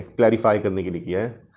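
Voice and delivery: normal talking voice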